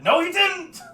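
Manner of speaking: high, loud angry denial; indignant shout. Acted. Wall faces yelling that the giant named nothing, angry. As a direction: snap it back in outrage.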